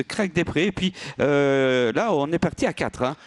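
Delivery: live trackside play-by-play; race caller. Real, not acted. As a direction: call the race as it unfolds.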